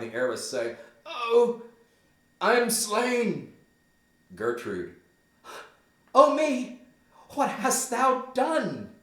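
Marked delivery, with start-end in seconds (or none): 1.0-3.5 high, slow stage death cry; dying Polonius. Acted. Stabbed behind the arras, high drawn-out dying cry. 6.1-8.9 high, loud, drawn-out dismay; horrified cry. Acted. Gertrude sees her son's killing, high horrified wail.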